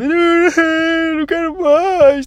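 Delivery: very high, very slow mock whimper of a child refusing food; imitating a small kid near tears; stretched-out childish plea to stop eating; crying child. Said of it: "chorar e de tipo".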